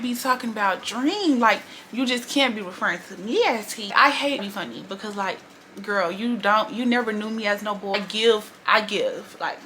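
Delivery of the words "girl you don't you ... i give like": ordinary delivery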